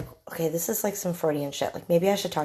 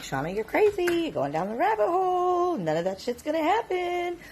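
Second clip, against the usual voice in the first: mock husband; 10 semitones higher, 4 dB quieter than usual. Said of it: "my husband has been like".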